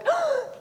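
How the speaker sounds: thrilled, sucked-in gasp; sharp acted gasp of surprise; re-enacted gasp. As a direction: gasp in amazed recognition. Acts out recognizing her accent.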